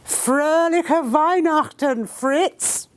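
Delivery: high, slow call across the trenches; yelled greeting. A narrator's reading. Harper shouts back to the German lines, high and slow. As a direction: hail the enemy lines in a long high call. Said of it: "yelled at the top of his voice".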